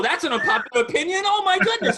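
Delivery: high, laughing shock; laughing disbelief. Real, not acted. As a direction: laugh it out in amazement. Reacting to a hot take.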